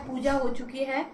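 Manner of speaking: ordinary delivery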